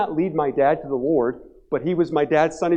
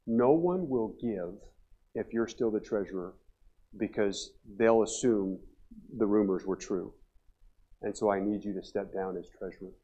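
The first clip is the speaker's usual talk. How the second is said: low, soft, halting account of a dismissal; grave retelling; 5 semitones lower, 9 dB quieter than usual. Retold or imitated. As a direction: break the bad news quietly, piece by piece. Retold dismissal, hurt old man.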